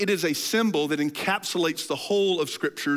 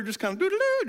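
humming walk-off; 14 semitones higher, faster than usual. Acted. Mimics Jesus walking off humming.

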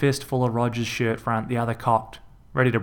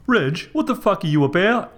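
higher, louder bloke's yell; 9 semitones higher, 4 dB louder than usual. A narrator's reading. Rog yelling as his brother wrecks the tinsel. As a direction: yell at him in disbelief. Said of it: "Rog Junior yelled".

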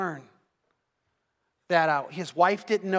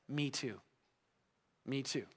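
much softer, barely above a murmur; soft hushed reply; 7 semitones lower, 15 dB quieter than usual. Retold or imitated. Preacher retelling Henry's answer to the housekeeper, softly hushed. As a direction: let it fall away, hushed and rueful.

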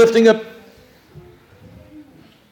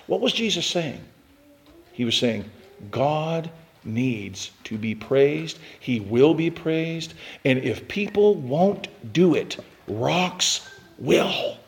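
hushed explanation; 4 semitones lower, 10 dB quieter, slower than usual. Real, not acted. Preacher drops to hushed explanation.

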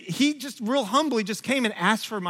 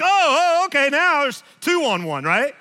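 higher, louder sarcastic protest; sarcastic outburst; 6 semitones higher, 6 dB louder than usual. Retold or imitated. Dad protesting two against one, louder and sarcastic. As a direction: cry foul, mock indignant. Wife takes son's side.

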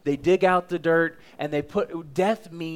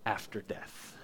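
soft whispered close; 7 semitones lower, 14 dB quieter than usual. Acted. Preacher hushing the congregation on the last words, whispering. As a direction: drop it to a breath. Hushed climax of the sermon.

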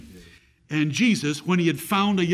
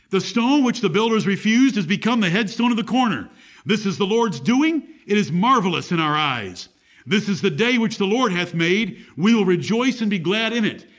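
raised, ringing pulpit reading of the psalm; scripture reading; 3 semitones higher, 3 dB louder than usual. Acted. Preacher reading Psalm 118 to his flock, raised and ringing. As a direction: proclaim the verses to the congregation.

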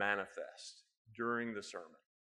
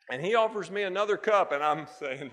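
laughing; 7 semitones higher, 10 dB louder than usual. Real, not acted. Laughing at his own story.

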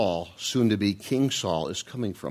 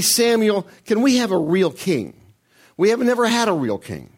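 pleading people; 9 semitones higher, 5 dB louder than usual. Retold or imitated.

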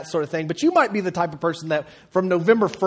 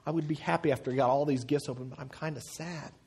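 imitating sad son; 7 dB quieter than usual. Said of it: "He said".

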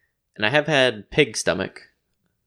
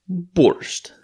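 borscht in a mock Russian accent, pitched higher; 9 semitones higher, 3 dB louder than usual. Shown as a demonstration. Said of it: "That's my Russian accent".

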